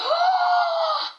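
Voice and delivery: sudden high yelp of pain; a sharp burnt-mouth cry. Real, not acted. Burnt by hot food.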